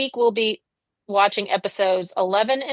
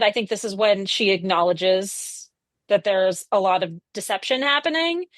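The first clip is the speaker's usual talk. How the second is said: ordinary talk, nothing marked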